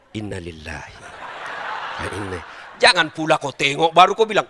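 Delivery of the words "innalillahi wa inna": muttered recitation. Shown as a demonstration. Husband muttering to angry wife.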